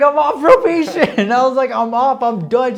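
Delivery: high, loud, laughing cry of news; squealing with relief, words stretched out; retold jubilant announcement. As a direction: re-enact shouting great news to mom. Re-enacts telling mom the news.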